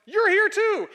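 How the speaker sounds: high, loud happy cry; husband thrilled to find his wife; delighted exclamation. Retold or imitated. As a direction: shout it with astonished joy.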